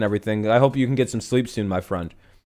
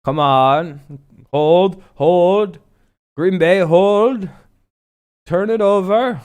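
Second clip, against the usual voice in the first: raised, urgent rooting in short bursts; pleading bettor; 7 semitones higher, 4 dB louder, much slower than usual. Acted. Streamer imitating bettors needing a stop, pleading. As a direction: beg the team on, pitch and volume climbing.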